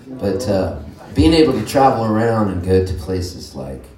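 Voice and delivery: ordinary talk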